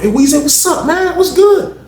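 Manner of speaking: high-pitched, eager greeting call; retold holler, pitch way up; hailing. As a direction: imitate someone calling out to a buddy. Acts out men hailing him.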